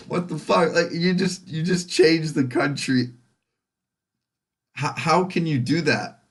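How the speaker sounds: high-pitched, indignant disbelief; incredulous. Retold or imitated. Calling out someone who switched countries, incredulous. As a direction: protest in exasperated amazement.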